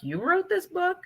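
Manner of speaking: high-pitched incredulous question; surprised. Retold or imitated. Students asking their teacher about her book, surprised. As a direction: imitate a child's astonishment, pitch right up. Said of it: "they're always surprised by".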